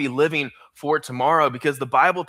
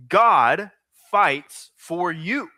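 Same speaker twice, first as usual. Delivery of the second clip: very slow, God punched loud; slow dictation; 3 semitones higher, 4 dB louder, much slower than usual. Shown as a demonstration. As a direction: hammer each word home slowly. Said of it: "Write this down".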